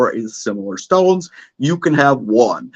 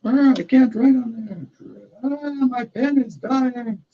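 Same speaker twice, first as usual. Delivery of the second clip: squeaky puppet voice; 11 semitones higher, 4 dB quieter than usual. Acted. Puppet character complains.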